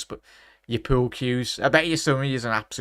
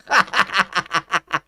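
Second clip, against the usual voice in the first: incredulous burst of laughter, louder; 8 semitones higher, 5 dB louder than usual. Real, not acted. Fan laughing at a wild near miss, incredulous laugh.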